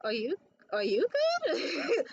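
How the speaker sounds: can't keep a straight face; laughing through it. Real, not acted. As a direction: question broken by real laughter. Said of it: "It was so funny".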